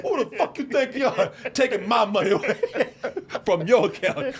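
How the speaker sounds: high mock outrage through laughter; hammed-up indignant caller; mad. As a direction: yell it as the cheated scammer, amused. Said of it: "Guy got mad".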